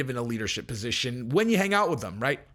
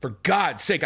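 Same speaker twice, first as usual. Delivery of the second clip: ordinary delivery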